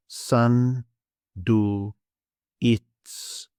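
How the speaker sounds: "sun, do, it's" as isolated Greek syllables. Shown as a demonstration. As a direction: sound out san, du, its with pauses. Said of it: "So we have".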